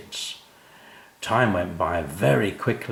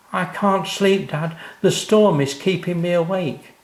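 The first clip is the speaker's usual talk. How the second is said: put-on little boy; 9 semitones higher, 3 dB louder than usual. Sam tells Dad the storm keeps him awake, high and childlike. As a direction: plead like a sleepless small boy. Scared child voiced by narrator.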